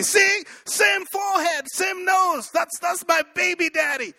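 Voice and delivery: very high, mock-shouted proof; shrieky imitation of an indignant talk-show mother; insistent mother. Acted mother insisting on the father.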